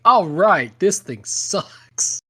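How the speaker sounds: loud, then slow disgusted verdict; mock disgusted outburst. Buyer regretting the giant TV, loud and disgusted. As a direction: declare it loudly, then drag it out. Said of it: "you're just like".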